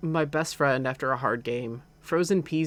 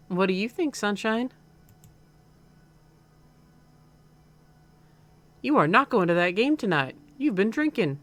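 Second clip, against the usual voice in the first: higher, firm Southern mom; slightly southern accent; 6 semitones higher, 4 dB louder than usual. Acted. Mom forbidding her son the game, slightly southern accent. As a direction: lay down the law in a light Southern accent. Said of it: "like, a slightly southern accent".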